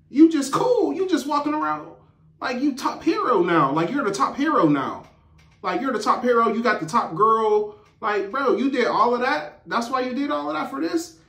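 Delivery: high-pitched acted rant; indignant. Emma taunting Sam as top hero, indignant. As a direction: pile on accusations, pitched high and incredulous. Emma's confrontation continues.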